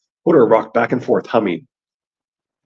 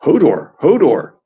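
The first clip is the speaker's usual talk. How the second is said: louder, higher frightened cries; 5 semitones higher, 5 dB louder, slower than usual. A narrator's reading. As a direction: cry the name out in mild fright. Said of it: "Hodor sounded frightened".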